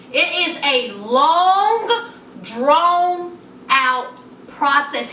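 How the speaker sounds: stretched out slow and deliberate; mock lawyer drawl, very slow; slow drawn out. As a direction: drag every word out like the lawyer. Mocking the lawyer's slow phrasing.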